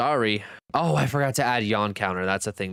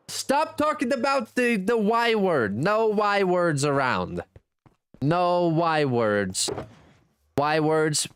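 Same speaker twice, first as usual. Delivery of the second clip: slow, higher mock scolding about the Y word; higher, slow playful scold; mock stern, drawn out; 6 semitones higher, slower than usual. Real, not acted. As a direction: tease the chat while banning the word.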